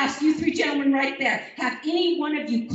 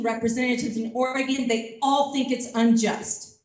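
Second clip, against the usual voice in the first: ordinary delivery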